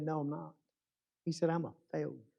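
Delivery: soft, downcast admission; understated sorrow, hushed and unhappy; retold dejection. Weeping violinist confessing failure to his friend, softly dejected. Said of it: "standing there and sobbing and crying".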